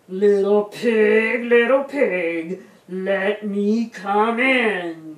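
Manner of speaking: loud, drawn-out wolf call; booming wolf voice, stretching every word; big bad wolf. A narrator's reading. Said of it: "cried the big bad wolf".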